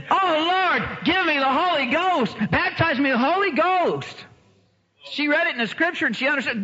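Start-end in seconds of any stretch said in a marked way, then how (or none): 0.1-4.2 high, drawn-out plea to God; re-enacted impassioned petition; acted fervent prayer. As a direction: cry out for it, pitched high. Acts out woman crying out to God.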